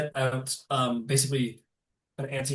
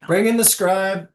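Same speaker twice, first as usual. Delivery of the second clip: commanding order; 7 semitones higher, 9 dB louder than usual. Acted. Lesh orders scribe back.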